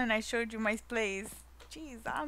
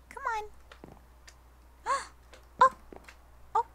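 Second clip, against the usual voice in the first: much higher coaxing call; 13 semitones higher, much faster than usual. Real, not acted. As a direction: coax the dog. Calling the pet.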